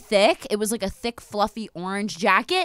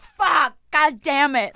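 loud self-directed frustration, acted out; mock exasperated outburst; frustrated cursing; 5 semitones higher, 4 dB louder, slower than usual. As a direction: curse yourself, louder and higher. Acting own frustration at overthinking.

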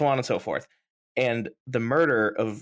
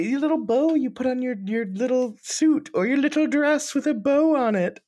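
funny voice; 4 dB louder than usual. Pretend. Mocking the name Bo to his cohost, high silly voice. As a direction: talk down to a toddler in a silly high voice. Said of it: "I said it in a funny voice".

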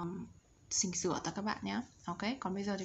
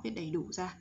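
ordinary talk, nothing marked

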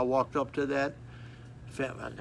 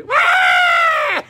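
piercing high loud wail; sudden shrill child's howl, very high and loud; child's scream; 16 dB louder than usual. Retold or imitated. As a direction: shriek like a child before the spanking lands. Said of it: "They scream out like that".

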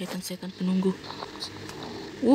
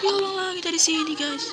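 shaken; 10 semitones higher than usual. Real, not acted. Shaken after scare.

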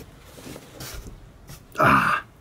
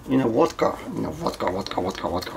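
each vodka lower and softer; fading out; 7 semitones lower, 5 dB quieter, much faster than usual. Real, not acted. Owner musing about the cat in the vodka box, fading lower and softer. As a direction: let it sink away into a murmur.

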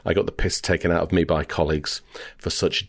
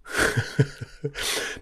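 breathy chuckle at own story; real soft laugh; amused laugh; 9 semitones higher, 6 dB quieter than usual. Real, not acted. Storyteller after his own punchline, soft amused laugh.

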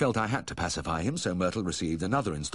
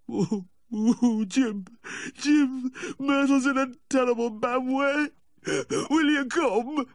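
very high, loud tearful wail; hammed-up sobbing Yorkshire drunk; distraught weeping; 5 dB louder, slower than usual. A narrator's reading. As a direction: wail down the phone, pleading for help. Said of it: "I could hear the distraught weeping".

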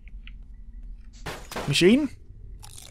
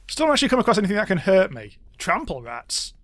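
ordinary talk

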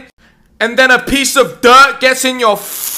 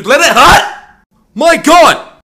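louder, drawn-out angry rant; fed-up yell at his parents; exasperated outburst; 3 semitones higher, 5 dB louder, much slower than usual. Acted. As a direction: throw it up in the air in disbelief. Ranting about parents' advice.

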